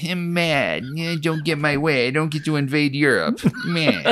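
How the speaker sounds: sulky whiny pout, softly; mock a sulking kid; pouting child voice. Voicing pouting Orlov.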